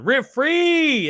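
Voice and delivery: high, loud call of the name; excited shout-out. Real, not acted. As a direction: call out his name in delight.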